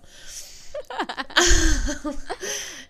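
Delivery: big high burst of laughter; loud laugh. Real, not acted. Laughing at her own joke about crying over shorts, loud and high. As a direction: crack up out loud.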